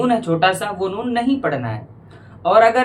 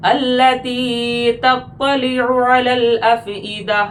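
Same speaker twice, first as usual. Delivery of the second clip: slow, sung tajweed recitation; Quran recitation; 5 semitones higher, much slower than usual. Shown as a demonstration. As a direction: chant the verse with full tajweed melody. Recites verse melodically.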